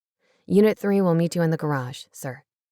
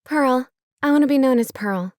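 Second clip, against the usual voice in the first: eager declaration; 5 semitones higher, 5 dB louder than usual. Acted. New name declared eagerly.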